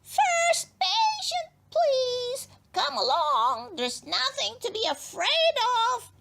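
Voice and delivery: chirpy gnome dentist calling; bright dentist. Acted. Crosswort brightly calls for his first patient. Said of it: "said brightly".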